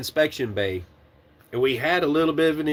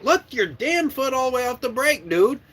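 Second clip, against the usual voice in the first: high, exasperated yell; losing patience; 11 semitones higher than usual. Retold or imitated. Trainer losing patience with the brake-riding trainee, exasperated yell. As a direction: snap at him, patience gone. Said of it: "I'm starting to lose my patience".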